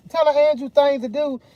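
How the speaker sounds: ordinary delivery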